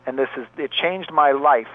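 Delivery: ordinary talk, nothing marked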